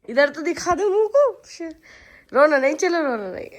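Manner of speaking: laughing while telling him not to cry; laughing coaxing. Real, not acted. Mother to crying son, laughing coax. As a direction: coax through a laugh, रोना नहीं said lightly.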